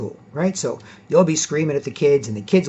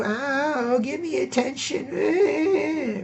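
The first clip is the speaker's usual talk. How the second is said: high, soft mocking whine; whiny attention seeker; 12 semitones higher, 5 dB quieter, much slower than usual. Mocking a kid whining for attention. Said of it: "you can't just go".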